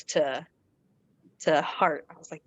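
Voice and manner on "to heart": normal talking voice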